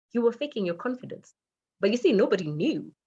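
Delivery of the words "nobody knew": normal talking voice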